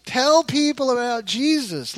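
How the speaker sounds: normal talking voice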